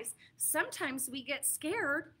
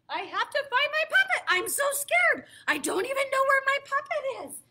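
high, louder playful fluster; hammed-up worried puppeteer; mock panic; 8 semitones higher, 5 dB louder than usual. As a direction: pretend to be frantic.

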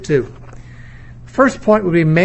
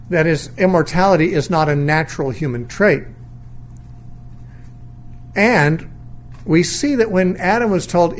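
ordinary talk

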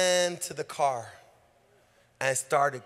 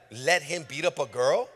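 normal talking voice

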